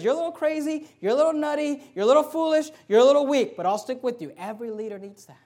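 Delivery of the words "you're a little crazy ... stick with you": high, loud, building list of "little" flaws; each "little" louder, then a warm landing; rising loyal affirmation. Acted. Armor bearer's loyal list.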